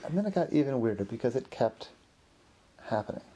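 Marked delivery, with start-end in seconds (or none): none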